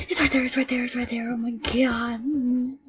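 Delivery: usual speaking voice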